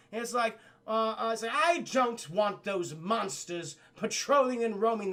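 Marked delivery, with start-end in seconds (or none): 1.5-5.1 slow put-on royal complaint; haughty princess. Princess objecting to gargoyles in her castle, haughty. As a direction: object slowly in a mock princess voice. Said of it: "but the princess, she calls over".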